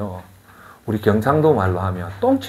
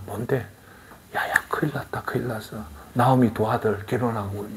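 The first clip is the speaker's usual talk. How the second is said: soft, secretive gossip, mostly unvoiced; hushed gossip; 9 dB quieter than usual. Preacher voicing villagers passing a secret rumour, hushed. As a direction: lean in and whisper the rumour. Whispered gossip about Naomi's ruin.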